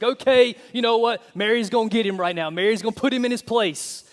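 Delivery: hammed-up glee as Mary's about to pounce; mock excited spectator. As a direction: cheer on the coming scolding. Spectator expecting Mary to scold.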